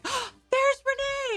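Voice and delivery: high, slow, delighted squeal; excited surprise. Retold or imitated. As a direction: shriek it like hearing a friend on air.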